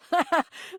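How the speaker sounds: short burst of laughter; amused laugh. Acted. Amused at companions' bickering, laughing. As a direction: laugh at a silly thought. Amused by own mental image.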